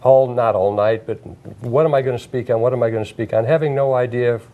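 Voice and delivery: usual speaking voice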